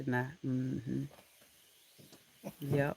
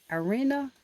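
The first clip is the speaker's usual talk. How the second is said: usual speaking voice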